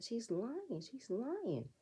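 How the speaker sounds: mock high-pitched denial. Imitating Candace denying it to the group, high and insistent. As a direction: squeak it out like a caught liar. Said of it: "And Candace said".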